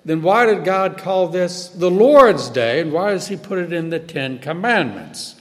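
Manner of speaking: ordinary delivery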